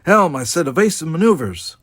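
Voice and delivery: high, loud, impatient command; snapped order. A narrator's reading. Kirk to helm under attack, snapping. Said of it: "Kirk snapped".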